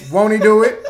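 loud gospel praise; church exclamation. Pretend. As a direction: shout it like church.

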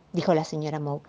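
plain reading voice

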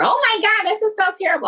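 high, hammed-up panicked wail; mock panic. Woman who just hit a car, in mock panic. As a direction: play the panicking victim, over the top. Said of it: "trying to give blame or".

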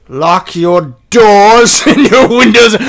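slow, loud and high, laughing through it; hammed-up menacing advice to the fans; mock creepy warning.

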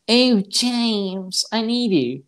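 high, swooning damsel impression; mock film lady. Co-host imitating the lady in the cabin, mock pleading. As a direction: put on a high, pleading lady's voice. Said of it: "the accent of that lady in the".

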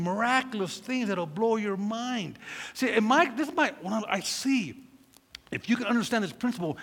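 usual speaking voice

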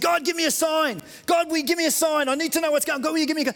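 high-pitched pleading shout; crying out to God. Retold or imitated. Preacher acting movie Bruce asking for a sign, crying out. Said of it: "he's crying out to God".

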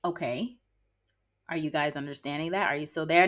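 ordinary talk, nothing marked

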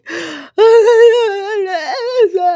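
high, loud wordless crying wail; mock sobbing wail. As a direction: imitate bawling incoherently into the phone. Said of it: "which is why I'm sobbing".

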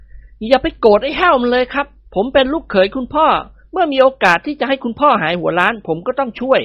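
high, playful voice of a joking son-in-law; cheeky young man. A narrator's reading. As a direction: tease him, then stop short. Nikorn soothes father-in-law. (ลูกเขยพูดกับพ่อตาแบบทะเล้น เสียงสูง)

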